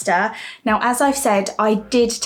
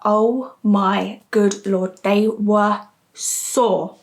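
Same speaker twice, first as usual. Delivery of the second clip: slow emphatic; much slower than usual. Real, not acted. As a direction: drag out every word slowly. Emphatic complaint about sore breasts.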